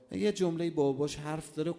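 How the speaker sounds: ordinary talk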